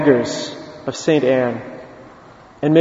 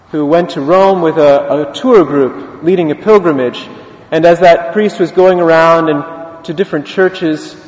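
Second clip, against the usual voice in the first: normal talking voice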